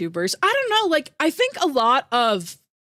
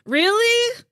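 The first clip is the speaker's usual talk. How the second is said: surprised; 11 semitones higher, 3 dB louder than usual. Real, not acted. Surprised by chat.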